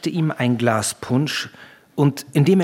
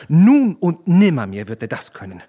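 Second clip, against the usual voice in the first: loud, emphatic denial; shouted refusal; 3 semitones higher, 6 dB louder than usual. A narrator's reading. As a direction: cry it out with full conviction. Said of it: "schrie der Student Anselmus". (nachdrücklich geschrien)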